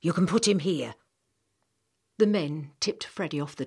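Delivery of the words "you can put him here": lightly voiced workman, a bit louder; a hint of a rough hauler giving orders; workman's voice. Workman telling the old man where to put the boy, lightly voiced. Men carrying in wounded boy.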